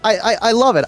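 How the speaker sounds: ordinary delivery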